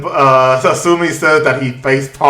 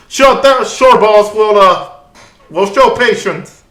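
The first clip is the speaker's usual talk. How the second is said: high guard voice; 8 semitones higher, 3 dB louder than usual. Acted.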